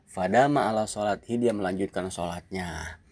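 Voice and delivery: usual speaking voice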